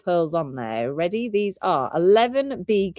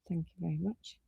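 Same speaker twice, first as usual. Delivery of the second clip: barely audible whisper; whispered thanks; 15 dB quieter, faster than usual. Real, not acted. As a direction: whisper a quick thank-you under your breath. Quiet aside during live sale.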